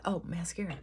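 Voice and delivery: ordinary talk, nothing marked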